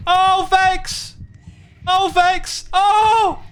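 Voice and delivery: high, loud panicked shriek; terrified shriek. Real, not acted. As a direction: shriek in real fright. Enemy Vex appears.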